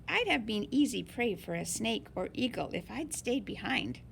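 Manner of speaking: ordinary delivery, nothing marked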